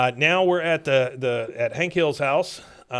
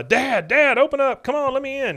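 much higher, loud pleading through a door; urgent retold shouting; 12 semitones higher, 5 dB louder than usual. Son banging on dad's door, urgent mock yelling. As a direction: imitate a son yelling to be let in.

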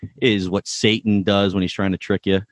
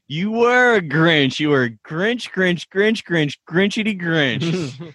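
higher, singsong teasing chant of Grinch; playful Grinch taunt; 7 semitones higher, slower than usual. Retold or imitated. Ted taunting Lily with a singsong Grinch chant. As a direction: tease her, chanting Grinch over and over. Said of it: "one of the best lines".

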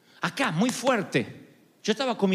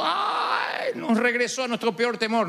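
high mock cry of self-pity; mock wail; 4 semitones higher, slower than usual. Preacher mocks crying churchgoers, high mock wail. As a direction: parody a weepy listener.

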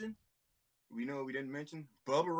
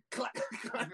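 imitated chair clack; 11 semitones higher than usual. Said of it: "all I hear is clack".

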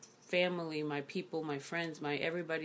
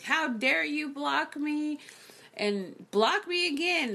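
higher, slow, indignant imitation; imitating outrage; 8 semitones higher, slower than usual. The blocked man coming back at her, higher slow mock outrage. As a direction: drag out his offended demand. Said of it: "came back at me like".

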